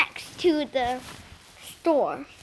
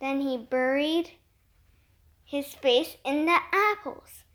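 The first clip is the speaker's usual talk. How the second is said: ordinary delivery, nothing marked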